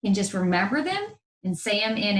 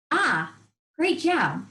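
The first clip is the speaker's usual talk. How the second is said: ordinary delivery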